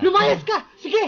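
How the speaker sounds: high, loud yell telling someone to get out; acted angry shout. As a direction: throw them out of the house, shouting. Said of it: "may i-reenact na ano". (pasigaw na galit na pinapalayas)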